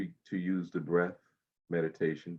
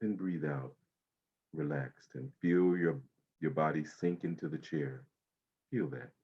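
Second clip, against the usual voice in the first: guided meditation. Acted. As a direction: ease them into calm breathing. Guides body-scan meditation.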